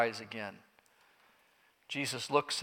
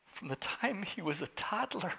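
tearful father; 4 semitones higher, 9 dB quieter than usual. Acted. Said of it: "With tears".

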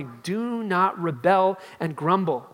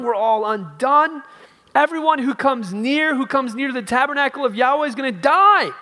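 loud, high, desperate crowd cry; panicked dread; 8 semitones higher, 8 dB louder than usual. Retold or imitated. The people crying to Moses in loud panicked dread. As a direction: cry out like people sure they will die. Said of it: "You see the dread conveyed with emphatic, emphatic repetition".

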